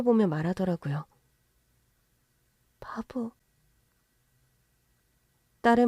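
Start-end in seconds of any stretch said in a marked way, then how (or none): 2.8-3.3 soft murmur. Acted. Daughter calls husband fool.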